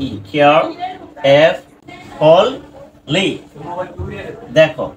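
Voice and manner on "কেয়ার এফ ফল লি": "কেয়ার… ফল… লি" with pauses between; teacher spacing out "কেয়ার, এফ, ফল, লি"; broken into parts. Shown as a demonstration. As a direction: sound out "কেয়ার", "ফল", "লি" separately. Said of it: "দুইটা ভাবে ভাঙতে পারি".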